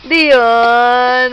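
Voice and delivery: loud, long drawn-out name; teasing build-up. Pretend. Mother teasing baby before the boo, loud drawn-out call. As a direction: hold the name to build suspense.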